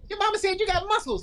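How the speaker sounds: put-on high, impressed girl's voice. Girl telling Jeffrey his mom bragged, high and impressed. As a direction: coo it like a girl who's impressed. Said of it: "when the girl say".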